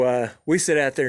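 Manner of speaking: normal talking voice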